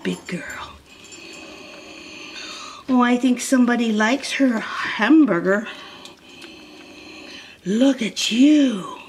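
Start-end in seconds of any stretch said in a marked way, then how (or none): 0.0-0.7 whispered praise. Real, not acted. Whispered to dog. 7.7-9.0 drawn-out slow doting coo. Real, not acted. Owner to her dog eating, slow doting coo.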